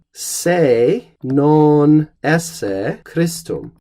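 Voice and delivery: se non esse Christum spelled out very slowly; slow model sentence. Shown as a demonstration. Teacher modelling the classical construction, very slow and deliberate. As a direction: draw out each word of the Latin. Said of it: "something like".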